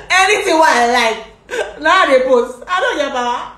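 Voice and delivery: high, loud laughing delivery. Real, not acted. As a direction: laugh while saying it. Laughing retelling.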